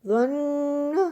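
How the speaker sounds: slow, deliberate recitation of each syllable; teacher reading a practice list aloud; syllable drill. Shown as a demonstration. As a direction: read each drill word clearly with a pause between.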